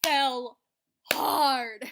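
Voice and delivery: loud, hammed-up crying wail; mock wail. Telling friends how hard she fell, a loud mock wail. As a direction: wail it like a soap opera. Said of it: "because your girl".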